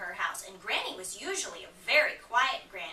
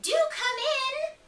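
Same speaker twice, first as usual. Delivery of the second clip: wolf slowly calling much higher as Granny; fake granny voice; 7 dB louder, slower than usual. Wolf disguised as Granny inviting Red in, high and slow. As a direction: coax her in with a high sweet granny voice. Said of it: "cried the wolf".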